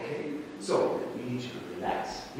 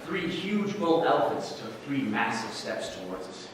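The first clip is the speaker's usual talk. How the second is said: normal talking voice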